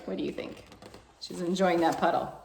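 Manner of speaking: usual speaking voice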